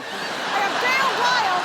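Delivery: loud, growled mock rage; mock fury. Comedian bellowing her exaggerated rage after the body scanner.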